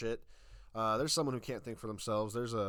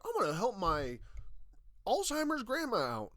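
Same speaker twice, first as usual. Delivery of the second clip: a mildly higher, louder mock kid voice; mocking teen; 9 semitones higher, 5 dB louder than usual. Ranting at the ad, voicing the kid mockingly. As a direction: lampoon the ad's earnest kid. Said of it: "gonna be using this to be like".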